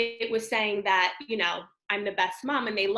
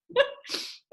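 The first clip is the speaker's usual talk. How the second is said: breathy laugh. Real, not acted. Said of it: "after the laughter".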